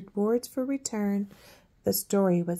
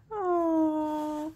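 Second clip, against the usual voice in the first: ordinary delivery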